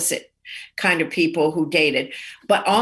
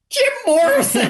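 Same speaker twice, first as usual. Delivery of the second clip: high, breathless-fan style mock squeal; starstruck outburst; 10 semitones higher, 4 dB louder than usual. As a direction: blurt the idol's name, pitched way up. Starstruck waitress names the customer.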